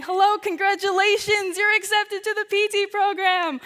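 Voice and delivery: a lightly voiced official delivering good news; polite office caller. P T department calling her with the acceptance, bright official voice. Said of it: "call from the P T department saying".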